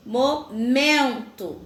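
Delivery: slow "momento" with a long, strong MEN; stressed syllable emphasized. Shown as a demonstration. Teacher showing pupils the strong syllable, stress on MEN in "momento". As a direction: draw out the middle syllable of "momento". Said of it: "pronunciadas com mais intensidade".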